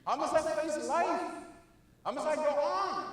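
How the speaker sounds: high, loud imitation of a mourner crying out; playing a griever calling out to the dead; mock grieving wail. Keynote speaker imitates mourners at a graveside, high and loud mock wail. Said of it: "They crying about".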